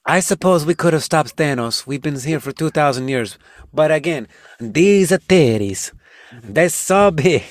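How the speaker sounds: put-on Italian accent. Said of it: "in an Italian accent".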